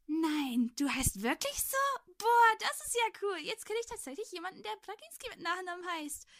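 high, gushing amazed delight; overjoyed boy. A narrator's reading. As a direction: burst out thrilled, like an excited kid. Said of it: "Er schien sich wirklich einen abzufreuen".

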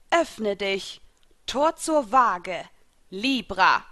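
slow, deliberate summoning call; summoning incantation. A narrator's reading. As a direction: proclaim the spell in measured phrases, ending loud. Summoning spell, later whispered in contrast. (feierliche Beschwörung)

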